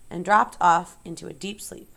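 ordinary delivery, nothing marked

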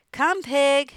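loud, high livestock call; farmer calling pig. A narrator's reading. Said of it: "said Mister Zuckerman".

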